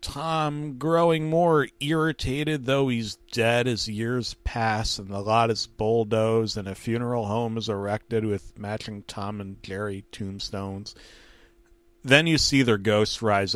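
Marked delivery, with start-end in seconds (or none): none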